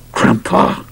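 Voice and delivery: hissed unvoiced warning; through clenched teeth. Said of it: "spoke through clenched teeth".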